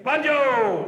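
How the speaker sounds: Bunjil! shouted high and loud, falling; called out loud. Shown as a demonstration. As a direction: call Bunjil! out with force. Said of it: "Can everybody say it again?".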